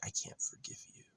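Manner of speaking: high, airy mocking whisper; mock her in a thin whispered squeak; mocking whisper of mother. Mocking how his mother dodges blame, high mock whisper. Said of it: "she switches it and she's like".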